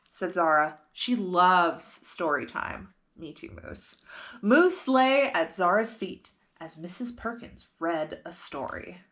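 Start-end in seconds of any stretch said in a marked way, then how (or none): none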